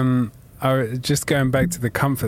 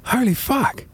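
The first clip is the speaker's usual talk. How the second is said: high, stunned exclamation; shocked; 12 semitones higher than usual. Retold or imitated. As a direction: gasp it out in disbelief. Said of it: "And I was just like".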